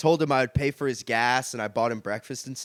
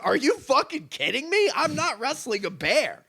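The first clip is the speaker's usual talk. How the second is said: incredulous; 10 semitones higher than usual. Retold or imitated. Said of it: "he's going like".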